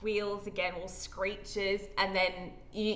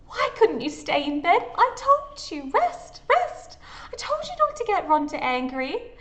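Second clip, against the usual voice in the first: scolding voice; 11 semitones higher, 5 dB louder than usual. Acted. Stella scolds the player character.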